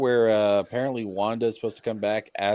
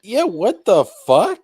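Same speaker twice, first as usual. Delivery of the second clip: high, slow stunned yeah; can't believe it; incredulous outburst; outraged disbelief; 8 semitones higher, 6 dB louder, slower than usual. Real, not acted. Outraged at patent.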